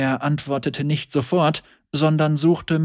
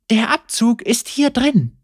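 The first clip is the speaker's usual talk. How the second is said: higher woman's voice, knowing; Senna explaining; 10 semitones higher, 3 dB louder than usual. A narrator's reading. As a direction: point it out with a knowing lilt. Senna taps his head.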